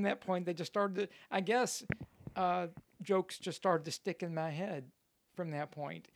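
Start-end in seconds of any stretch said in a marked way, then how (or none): none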